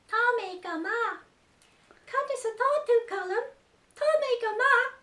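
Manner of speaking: a tiny excited puppet shouting hello; puppet character voice. Acted. Puppet greeting the children in Irish, high and squeaky. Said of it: "two new characters".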